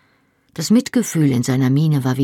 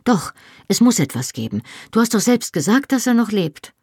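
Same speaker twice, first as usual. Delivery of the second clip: panicked; 7 semitones higher than usual. A narrator's reading. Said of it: "Panisch entriss ich ihm meine Hände".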